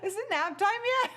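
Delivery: laughing through the words; giggly and quick. Real, not acted. Laughing at own tiredness.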